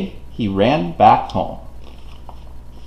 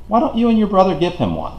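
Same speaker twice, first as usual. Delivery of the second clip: higher, fast fatherly suggestion; father's voice; 8 semitones higher, faster than usual. A narrator's reading. Father answers his daughter, quick and higher. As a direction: toss it off quickly as the dad. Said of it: "her father said".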